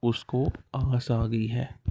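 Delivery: slow and soft "उसको आस आ गई है", each word spelled out; teacher's slow soft sound-out; pronunciation demo. Shown as a demonstration. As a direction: say "आस" slowly so it echoes fiasco. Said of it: "इसको कैसे pronounce करना है?".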